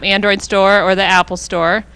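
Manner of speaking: ordinary delivery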